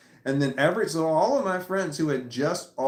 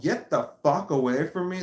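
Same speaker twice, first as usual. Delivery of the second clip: usual speaking voice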